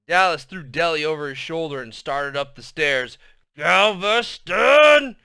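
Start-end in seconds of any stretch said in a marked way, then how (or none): 3.5-5.2 high, loud, slow drawn howl. A narrator's reading. Deli howling her sister's name while carried off, loud. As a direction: howl the name across the room. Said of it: "she howled".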